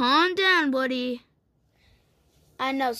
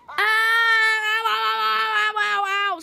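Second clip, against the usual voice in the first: higher, louder ow ow ow; pretend pain cries; 8 semitones higher, 5 dB louder than usual. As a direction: yelp as if being yanked. Pull string yanked, pretend pain.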